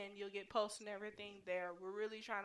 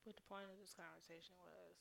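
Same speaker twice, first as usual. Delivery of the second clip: ordinary delivery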